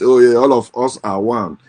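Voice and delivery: usual speaking voice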